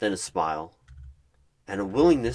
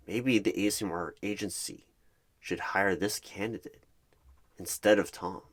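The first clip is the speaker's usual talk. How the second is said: ordinary delivery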